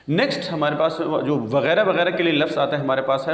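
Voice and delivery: ordinary talk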